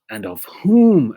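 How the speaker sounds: WHOM drawn out, high and loud; slow swell into a ringing WHOM; emphatic scripture reading. Real, not acted. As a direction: leap up and hold "whom". Stresses key word while reading.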